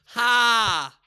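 short forced ha; one fake burst of laughter. Shown as a demonstration. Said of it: "you just fake laugh".

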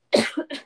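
sharp real cough; coughing fit mid-sentence. Real, not acted. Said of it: "I'm coughing so much".

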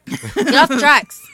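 loud, drawn-out mock yell; guard's shout. Co-host acts out the guard's warning, mock shouting. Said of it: "hearing shouts from a security guard".

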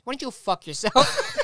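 a mock snarl broken by laughter; laughing retort. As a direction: toss it back with a laugh. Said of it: "he goes".

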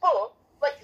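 ordinary talk

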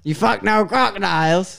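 loud, hammed-up Aussie; Australian accent. Guest grills co-host about crocodiles, hammed-up Australian accent. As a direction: interrogate in a broad mock Australian accent. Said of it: "I'm going Australian".

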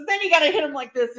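high, loud and fast; hammed-up gangster shout; mock Pesci yell. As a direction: bark the instruction. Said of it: "He goes".

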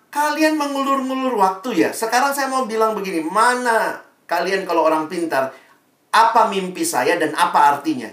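an impatient king scolding his wise men; angry king. Acted. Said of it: "Eh, marah dia".

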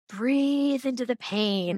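slow, drawn-out self-coaching; breathing through pain. Acted. Mother acting out painful nursing, slow breathe-through-it mantra. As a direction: talk yourself through it, stretching each word.

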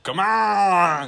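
loud, drawn-out Boston-accent holler; hammed-up Boston accent shout; deep Boston accent. Shown as a demonstration. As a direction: stretch it out in a put-on Boston accent. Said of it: "it's like deep".

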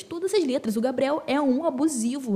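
normal talking voice

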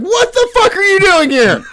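high, loud, laughing mock shock; shocked wife. Wife catches her husband who followed her into the store, shrieking. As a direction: squeal it in disbelief. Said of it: "she's like".

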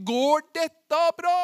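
loud, high, drawn-out question; anxious doubting question. Acted. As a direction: call out the doubt slowly, rising at the end. Acting Peter torn by doubt.

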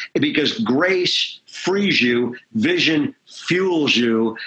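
grace frees you, vision fuels you, spaced out slowly; measured preacher refrain; slow catchphrase. Real, not acted. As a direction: land each phrase deliberately. Sermon catchphrase delivered slowly.

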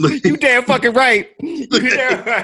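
high, laughing church-auntie voice; sassy auntie voice. Pretend. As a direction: play the sassy potluck auntie. In character as Tiffany, laughing.

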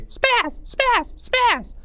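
very high sing-song spaz chant; a playground taunt in a squeaky voice; high-pitched voice. Acted. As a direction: squeak it out to tease. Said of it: "in a high-pitched voice".

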